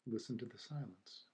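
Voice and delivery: unhurried meditation guide, long silences between phrases; guided meditation. Acted. Teacher guiding listeners to feel the silence after the chime, calm meditation voice. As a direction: ease listeners into stillness, leaving space after each phrase.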